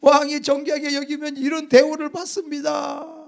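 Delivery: loud, high public announcement, first word stretched out slowly; humiliated Mordecai's loud cry to the townspeople; forced proclamation. Acted. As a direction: shout it to the gathered crowd, pitched high. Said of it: "소리를 질러야지".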